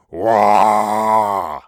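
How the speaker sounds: loud, sustained monster wail; loud held "waah" roar; monster cry. A narrator's reading. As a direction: bellow a long cry as a monster. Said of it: "the monster exclaimed".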